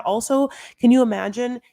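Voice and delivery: ordinary delivery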